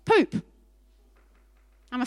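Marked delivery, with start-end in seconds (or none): 0.1-0.4 blurted punchline. Real, not acted. Answering what's in a barn.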